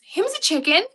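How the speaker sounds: loud, high-pitched cutesy exclamation. Real, not acted. Baby talk about her parrot.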